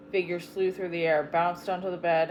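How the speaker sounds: ordinary delivery, nothing marked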